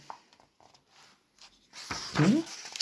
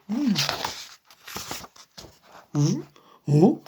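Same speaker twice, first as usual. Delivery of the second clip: ordinary delivery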